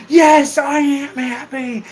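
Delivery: high holler with a drawn-out finish; shouting out. Shown as a demonstration. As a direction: holler it like a drunk at a parade. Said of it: "wherever you have to shout out something".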